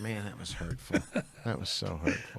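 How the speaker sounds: soft, put-on wounded tone; mock hurt. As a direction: pretend your feelings are hurt. Mock wounded reply to scolding.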